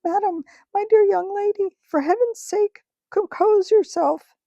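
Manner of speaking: put-on a frightened old uncle begging her to calm down; shrill terrified pleading; trembling scream. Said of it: "of trembling scream".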